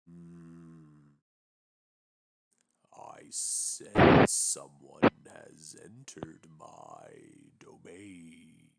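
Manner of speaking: low, slow, ominous voice; a slow menacing voice from the depths; deep echoing voice. Acted. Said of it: "you hear a deep voice echoing throughout your head".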